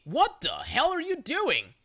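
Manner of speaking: high, slowed exasperated outburst; aggravated. Retold or imitated. Narrator to crazy lady over cart, aggravated and incredulous. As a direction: snap back at her, fed up. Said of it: "I'm aggravated".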